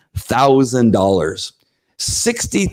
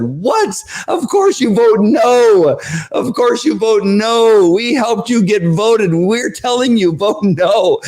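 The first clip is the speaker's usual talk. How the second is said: crowd outrage; 11 semitones higher, slower than usual. Retold or imitated. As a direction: demand it, exasperated. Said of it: "everybody said".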